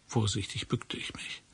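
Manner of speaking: a careful, breathless whisper; soft whispered narration; hushed whisper. A narrator's reading. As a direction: whisper it as if someone might hear. Careful bending in dark warehouse.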